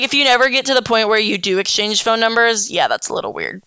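usual speaking voice

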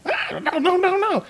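very high squeaky pleading; panicked goblin. Acted. Goblin begging not to be hurt, high panicked squeak. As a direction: cry out in fear.

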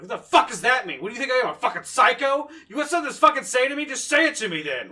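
acted high, loud, furious tirade; angry yelling. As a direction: yell it like picking a fight. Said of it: "I raise my voice and yell".

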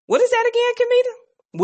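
high calling voice. Real, not acted. Calling to co-host.

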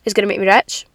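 usual speaking voice

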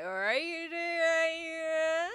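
mock West Country garble; Gordon. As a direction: stretch the vowels in thick Devonian. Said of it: "That's literally how Gordon speaks".

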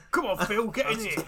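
hammed-up urgent summons; Grant Mitchell. Hard man urging Phil inside, loud mock bellow. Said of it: "He becomes Grant Mitchell".

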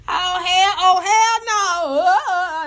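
high, slow drawn-out hell no; shocked refusal. Real, not acted. Reacting to a line in the diss track, high drawn-out hell no. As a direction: stretch out the outrage.

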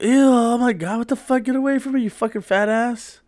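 put-on high, loud, disgusted girl voice; mock outraged girl yelling get away; disgusted girl shriek. Girl rejecting him in disgust.

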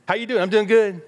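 high, perky greeting and pat reply; mock the automatic everything's-fine exchange; breezy small talk. People swap routine greetings to look okay, breezy.

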